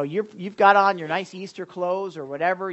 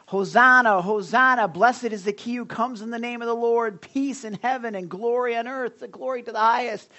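raised, high cheering cry of praise; crowd shouting Hosanna; 6 semitones higher, 5 dB louder than usual. Retold or imitated. Pastor voicing the crowd's cry to Jesus, loud and high. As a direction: call it out like the crowd at the gate. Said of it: "And they were shouting that".

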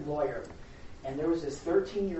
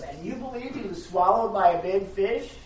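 raised, louder mock disbelief; mocking lawyer; 4 semitones higher, 7 dB louder than usual. Lawyer questioning girl on the fish, mocking. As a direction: taunt her with an incredulous question. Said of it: "just mocking her".

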